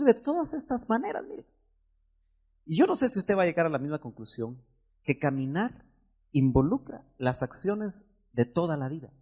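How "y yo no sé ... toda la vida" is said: ordinary talk, nothing marked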